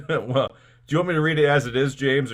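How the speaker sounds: ordinary talk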